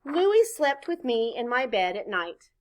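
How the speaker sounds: ordinary delivery, nothing marked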